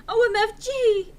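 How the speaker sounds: loud, high gasp of delight; swooning. Acted. Enderby gushing after Draco's song, loud high. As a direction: gush it out, starstruck.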